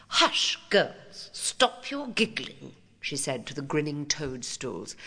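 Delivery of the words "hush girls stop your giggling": lightly voiced stern headmistress; prim schoolmarm. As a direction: hush them like a prim teacher. Headmistress hushes the pupils.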